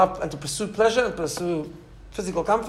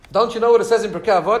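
higher, louder quoted challenge; challenging; 5 semitones higher, 7 dB louder than usual. Retold or imitated. Donor challenges the rabbi from scripture, challenging. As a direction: confront him with the text. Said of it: "so he challenged him".

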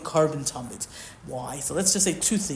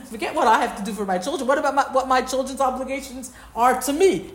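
higher, hurried, lightly acted protest; indignant retort; 6 semitones higher than usual. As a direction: retort as if turning the demand around. Voices Hashem's retort to Moshe.

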